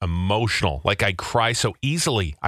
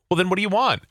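rising impatience, higher; pushing for an answer, fed up; exasperated; 9 semitones higher, faster than usual. Retold or imitated. Acting out the dinner argument.

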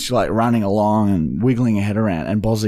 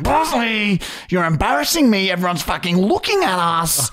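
high, strained mock scolding; holding back rage; 7 semitones higher than usual. Embarrassed owner to dog in park, held-back rage. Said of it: "I'm holding back rage, being like".

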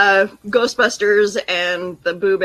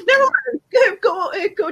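laughing; 10 semitones higher than usual. Real, not acted. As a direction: crack up while speaking. Laughing fit mid-show.

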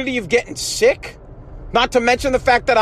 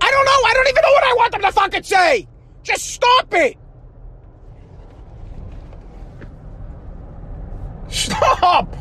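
frustrated outburst; 13 semitones higher than usual. Real, not acted. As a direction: blow up in real frustration. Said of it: "I'm frustrated".

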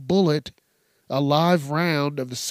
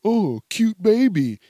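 put-on high, slow, louder baby-talk coo; 7 semitones higher, 4 dB louder than usual. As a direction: gush over the baby in a pitched-up sing-song. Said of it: "run up to someone's baby and go".

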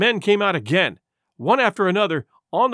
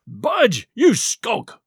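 scornful cried rebuke; Pugh; 4 semitones higher, much slower than usual. A narrator's reading. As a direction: throw the word back as an angry cry. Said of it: "cried Pugh".

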